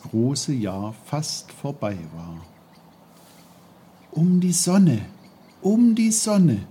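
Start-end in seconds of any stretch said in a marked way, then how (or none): 4.1-5.1 put-on high, louder boyish voice, wondering; a boy dreamily repeating a thought; young Peter musing. A narrator's reading. Peter thinking aloud, musing in a high boyish voice. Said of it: "dachte er bei sich". 5.6-6.7 put-on higher still, louder, dreamy boyish echo; young Peter musing. A narrator's reading. Peter echoing his own thought, even higher and dreamier. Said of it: "dachte er bei sich".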